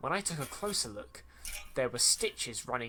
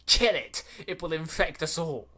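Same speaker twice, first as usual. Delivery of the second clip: loud, higher-pitched panicked-order yell; raised-voice storybook shout; screamed yell; 4 semitones higher, 7 dB louder than usual. A narrator's reading. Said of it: "he screamed and yelled".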